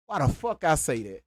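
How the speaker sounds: usual speaking voice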